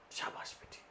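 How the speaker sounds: whispered sarcastic praise; mock praise. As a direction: hiss out fake approval. (फुसफुसाती व्यंग्य भरी शाबाशी)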